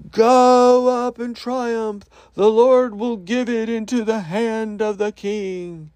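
slow, high, sneering mock agreement; sarcastic, telling the king what he wants to hear; mocking sarcasm. Prophet mocks the king with false good news, sarcastically. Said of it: "mockingly because he is".

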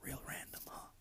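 whispered sarcastic aside; mock whisper. Podcaster mocking a Tinder bio, whispered. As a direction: hush it like a snide secret.

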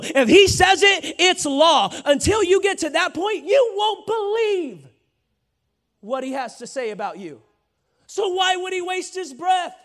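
loud, high, building sermon climax; full force preaching. Real, not acted. Preacher building to a climax for the congregation, shouting.

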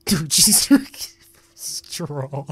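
loud laughing shout; laughing loud disbelief. Real, not acted. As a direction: blurt it out through laughter. Shocked at video, laughing loudly.

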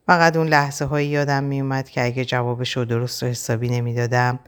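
plain reading voice

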